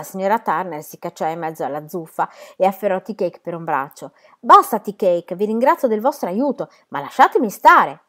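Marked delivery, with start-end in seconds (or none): 4.4-8.0 loud plea to stop; firm, loud interruption; urgent protest. A narrator's reading. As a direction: break up a fight with a raised voice. Owner breaks into brawl to stop it.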